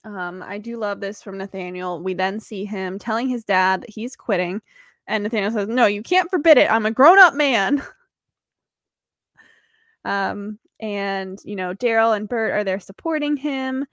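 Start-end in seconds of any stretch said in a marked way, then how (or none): 5.7-7.8 louder indignant quote; mock defiance. Nathaniel telling his dad he's quitting, mock defiant. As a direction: stand up to dad, lightly hammed-up.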